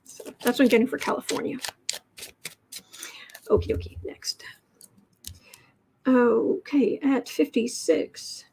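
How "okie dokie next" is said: breathy whisper to herself; whispered aside. Real, not acted. Moving on to the next card, whispered to herself. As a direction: murmur it under your breath.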